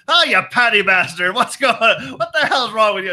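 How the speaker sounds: loud, boisterous mock Irish accent; drunk Irish accent. Comedian insulting a drunk Irishman back, loud mock Irish accent. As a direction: bellow it like a drunk Irishman, roughly faked. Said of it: "I speak drunk Irish, but he goes".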